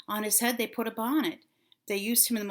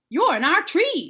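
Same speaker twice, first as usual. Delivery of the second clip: cross snap; 7 semitones higher, 6 dB louder than usual. A narrator's reading. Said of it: "snapped the crows crossly".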